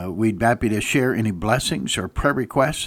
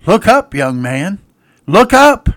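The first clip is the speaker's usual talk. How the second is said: loud call climbing high on the second look up; preacher's shout; 7 semitones higher, 9 dB louder, slower than usual. Retold or imitated. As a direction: shout it up to the young man. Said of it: "preacher shouts out".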